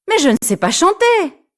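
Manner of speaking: put-on high girlish protest. Elsa protests shyly.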